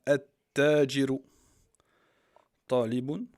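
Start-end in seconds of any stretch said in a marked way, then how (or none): 0.1-1.2 "At-Tajiru" loud and slow, no L, held T; doubled t. Shown as a demonstration. Teacher demonstrating the merchant word, T doubled loudly and slowly. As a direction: hit the double T in "At-Tajiru", stretched out. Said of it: "on le prononce comme ça".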